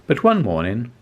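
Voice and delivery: usual speaking voice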